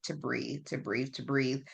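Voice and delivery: soft, hushed self-coaching to breathe; telling myself to breathe. Retold or imitated. Alone in the crowd, calming herself, soft and hushed. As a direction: talk yourself down, quietly and steadily.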